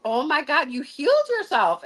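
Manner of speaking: high, emphatic retold amazement; amazed exclamation. Healer replying to the woman who recovered, amazed exclamation.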